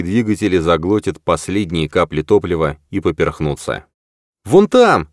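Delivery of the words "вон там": громко и высоко выкрикнуть; крикнуть, указывая вперёд; внезапный выкрик. Acted. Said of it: "внезапно выкрикнул Том". (внезапный громкий выкрик)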